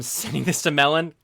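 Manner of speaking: ordinary talk, nothing marked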